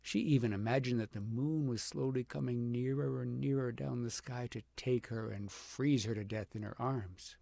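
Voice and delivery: plain reading voice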